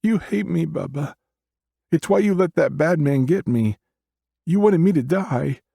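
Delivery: put-on very high, hurt accusing child; little girl voice.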